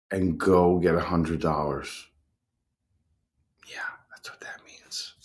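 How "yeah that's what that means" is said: whispered aside. Real, not acted. Streamer grumbling about landscapers, whispered aside. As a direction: breathe it out with no voice.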